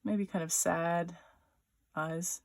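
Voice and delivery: normal talking voice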